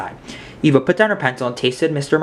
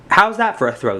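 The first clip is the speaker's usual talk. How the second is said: proud; 7 semitones higher than usual. A narrator's reading. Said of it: "the limo driver proudly asked".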